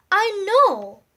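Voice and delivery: excited. A narrator's reading. Excited character answer.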